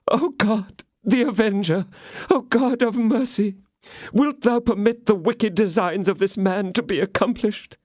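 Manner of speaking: high, lightly choked plea to God; a hammed-up tearful appeal; tearful prayer. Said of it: "with a voice almost choked by tears".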